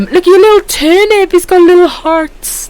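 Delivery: cooing. Real, not acted. Cooing over the emote again.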